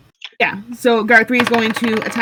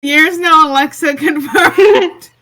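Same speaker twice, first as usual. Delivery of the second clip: higher, louder, laughing through the words; 5 semitones higher, 6 dB louder than usual. Real, not acted. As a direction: announce it while cracking up. Laughing at the robotic pain act.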